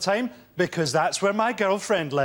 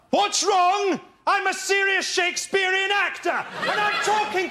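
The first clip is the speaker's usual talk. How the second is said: exasperated shout; 10 semitones higher than usual. Acted. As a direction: blow up like an indignant Shakespearean actor. Picard outburst, frustrated actor.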